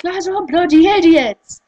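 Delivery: loud, high exasperated retort; mock angry outburst. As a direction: blurt it out in mock outrage.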